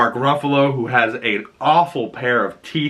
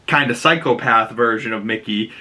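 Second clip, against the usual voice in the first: ordinary talk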